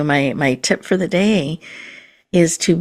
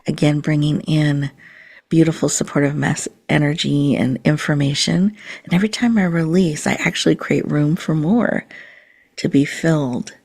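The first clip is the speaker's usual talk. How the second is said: guided meditation. Acted. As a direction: gently guide the listener into calm and presence.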